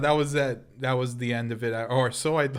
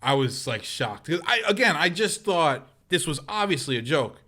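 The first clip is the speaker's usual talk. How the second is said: usual speaking voice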